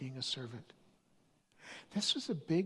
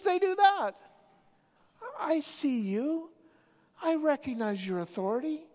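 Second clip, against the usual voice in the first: centurion; 9 semitones higher, 6 dB louder than usual. Retold or imitated. Centurion quoted, growing fervour.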